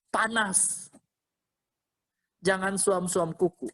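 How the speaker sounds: normal talking voice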